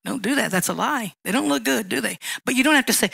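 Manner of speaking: normal talking voice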